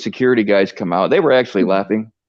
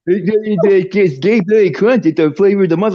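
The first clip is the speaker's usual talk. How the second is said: higher, mock-defensive barista voice; 6 semitones higher than usual. Storyteller mocks the Starbucks barista defending the flavor, in a higher whiny voice. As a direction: play the barista whining back, pitched up.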